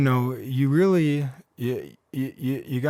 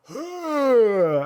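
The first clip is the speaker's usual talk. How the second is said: sharp high gasp, much louder, the air knocked out; shocked gasp; 11 semitones higher, 6 dB louder than usual. Retold or imitated. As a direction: gasp as if punched awake in the chest. Woken by a punch to the chest.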